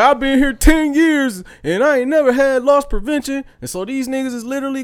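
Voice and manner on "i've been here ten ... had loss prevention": loud, high mock indignation; taking it personally. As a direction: imitate an offended vendor arguing back. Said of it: "took that shit personally".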